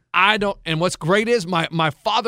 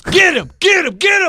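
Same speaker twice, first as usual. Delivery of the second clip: shouted quote; 11 semitones higher, 6 dB louder than usual. Retold or imitated. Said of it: "he goes".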